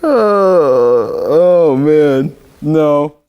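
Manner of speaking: loud, groaning exasperation; loud frustrated groan of defeat; exasperated. Real, not acted. Anchor after a botched take.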